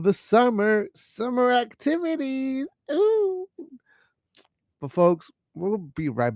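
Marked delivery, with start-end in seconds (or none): 2.9-3.5 very high ooh squeal; mock excited squeal. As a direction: squeal with fake delight.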